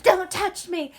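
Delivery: high, loud retort; mock angry snap. Ashleth pushing away Dean's comfort, mock angry. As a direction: snap it out, recoiling from a touch. Acting character rejecting touch.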